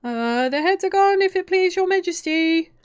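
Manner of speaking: high, sustained soldier's shout; shouted reply. A narrator's reading. Soldiers reporting to the Queen, shouted. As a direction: call it back loudly on one high pitch. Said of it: "the soldier shouted in reply".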